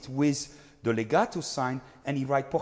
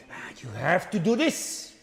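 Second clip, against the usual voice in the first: slow, louder urging; mock urging; 5 semitones higher, 6 dB louder than usual. Shown as a demonstration. Coach acting out the urgent confidant, slow and louder. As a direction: press the secret on them. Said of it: "then it goes back".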